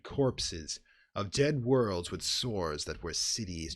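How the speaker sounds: ordinary delivery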